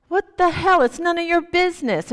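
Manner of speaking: loud, high angry retort; angry drunk. Retold or imitated. Drunk laborer angrily rebuffs the old man, loud. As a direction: bark him off, annoyed.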